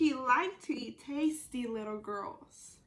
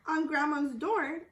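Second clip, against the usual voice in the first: plain reading voice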